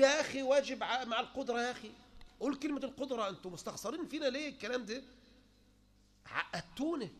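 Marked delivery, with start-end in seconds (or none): 0.0-1.6 urging the preacher, louder and higher; mock louder plea. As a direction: call out يا أخي higher and louder.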